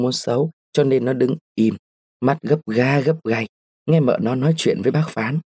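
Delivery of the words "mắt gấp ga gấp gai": plain reading voice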